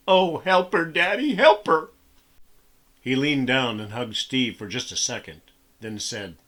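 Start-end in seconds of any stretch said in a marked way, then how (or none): 0.1-1.9 higher, louder, sobbing plea; crying plea. A narrator's reading. Boy clinging to father's leg, crying plea. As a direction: beg his father through tears.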